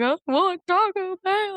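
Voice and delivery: put-on high, slow whine from a kid; whiny child. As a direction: drag it out like a hungry child. Kid whining for food.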